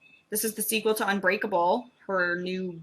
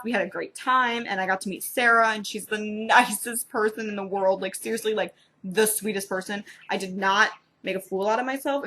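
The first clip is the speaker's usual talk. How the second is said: ordinary talk, nothing marked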